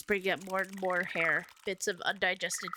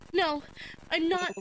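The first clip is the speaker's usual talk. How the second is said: frantic, pitched-up protest; high panicked denial; 9 semitones higher than usual. Acted. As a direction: deny it in rising panic.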